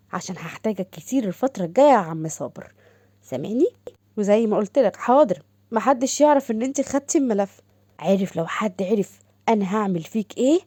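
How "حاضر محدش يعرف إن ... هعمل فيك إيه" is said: ordinary delivery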